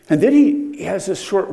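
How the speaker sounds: excited restart; sudden excitement. Real, not acted. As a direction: jump loud and high on "And then he". Preacher excited to continue.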